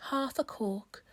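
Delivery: usual speaking voice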